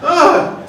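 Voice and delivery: high, loud exasperated groan. Retold or imitated. Fun uncle after yelling at kids, exasperated groan. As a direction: groan at the end of your patience.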